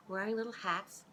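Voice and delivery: ordinary delivery, nothing marked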